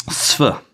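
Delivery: "sf" sounded out alone, s sliding into f; sf blend sound. Shown as a demonstration. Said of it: "So S P H".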